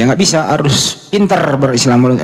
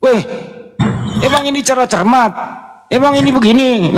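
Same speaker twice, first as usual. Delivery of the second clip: much higher, indignant mock protest; mock indignant pastor; 10 semitones higher than usual. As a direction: squeal in offended disbelief. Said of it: "Marah dia". (meniru pendeta yang marah, nada jauh lebih tinggi)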